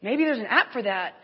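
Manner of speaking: ordinary delivery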